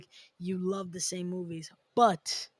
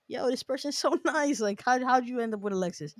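higher-pitched, gushing mock admirers; gushing crowd; 5 semitones higher, 9 dB louder than usual. Host voices friends raving about the kind partner, gushing. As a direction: gush like everyone who adores them, building to a loud finish. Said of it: "everyone is like".

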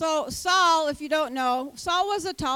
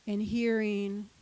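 ordinary talk

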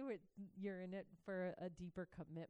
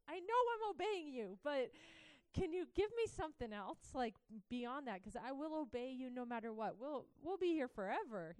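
higher and louder, pressing God for an answer; pleading frustration; 5 semitones higher, 5 dB louder than usual. Retold or imitated. Frustrated believer pleading with God for an answer, raised and urgent. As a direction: push hard, exasperated and urgent.

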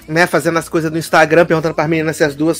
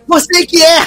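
mock retort; 11 semitones higher, 3 dB louder than usual. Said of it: "E aí ele fala assim".

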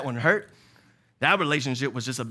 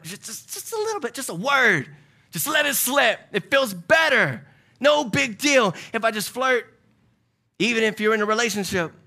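building excitement; 8 semitones higher, 4 dB louder than usual. Pretend. Voicing temptation, rising intensity.

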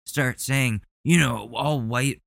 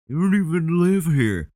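higher, louder mock of the man; 8 semitones higher, 4 dB louder than usual. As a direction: parrot the man's sneer. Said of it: "And he's doing this".

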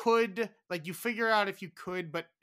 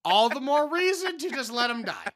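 mock exasperation; 8 semitones higher, 6 dB louder than usual. Mock rant about pandas.

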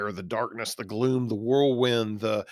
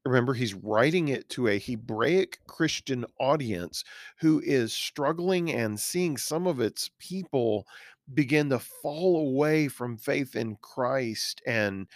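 ordinary talk, nothing marked

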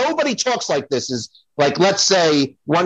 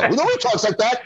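usual speaking voice

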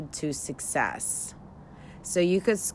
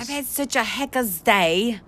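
lightly put-on Australian accent, higher; Aussie accent; 6 semitones higher, 4 dB louder than usual. Trying out the slang for her co-host in an Aussie accent. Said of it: "Try doing it in your Aussie voice".

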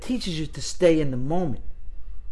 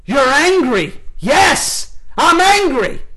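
loud, high acted anger; angry shouting; 10 semitones higher, 6 dB louder, much slower than usual. Shown as a demonstration. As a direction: fire it back in a full angry yell. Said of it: "it always would start out something like this".

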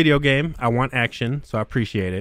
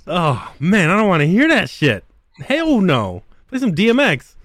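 exasperated rant; 6 semitones higher, 3 dB louder than usual. Real, not acted. Ranting about the music.

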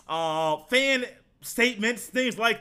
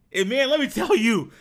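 normal talking voice

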